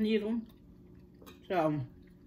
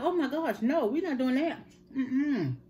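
high, laughing no-way reaction; laughing refusal; 6 semitones higher than usual. Retold or imitated. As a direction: laugh it off while refusing firmly. Refusing the long needle.

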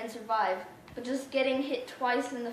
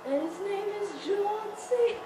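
mock announcer; 10 semitones higher than usual. Hyping a name like announcer.

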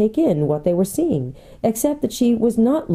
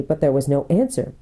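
ordinary delivery

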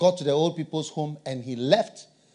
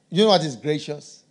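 ordinary talk, nothing marked

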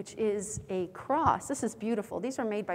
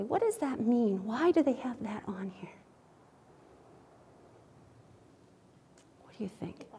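whispering. Real, not acted. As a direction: whisper it like a secret. Whispered prompt to the kids.

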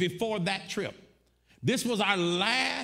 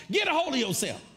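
high, emphatic imitation of dad; comic retold telling-off; scolding father; 6 semitones higher, faster than usual. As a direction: scold sharply as the father, pitched up. Father scolds in retold phone call.

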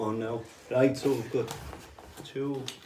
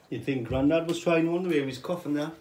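normal talking voice

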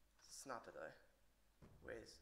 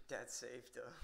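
laughing; 3 semitones higher, 4 dB louder, slower than usual. Real, not acted. Laughs at his own rude remark.

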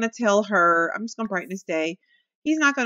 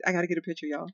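ordinary talk

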